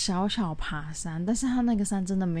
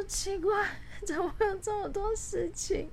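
exasperated disbelief; 14 semitones higher, 4 dB quieter than usual. Retold or imitated. Exasperated inner thought, factory breakdowns.

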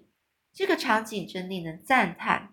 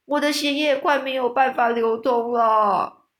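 higher, loud complaining cry; bloodthirsty giant crying out in distress; 4 semitones higher, 4 dB louder than usual. A narrator's reading. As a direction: call it out strained. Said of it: "叫着说".

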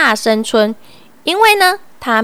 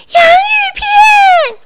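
much higher, loud, slow straining shout; shout with all his strength; 6 dB louder than usual. A narrator's reading. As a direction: give it every ounce of breath. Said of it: "全身的力气大声说".

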